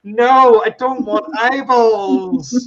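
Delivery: loud, high, drawn-out wail of refusal; mock horrified protest. Dad refusing his son's pretend dish, mock horrified wail. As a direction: wail it in playful outrage.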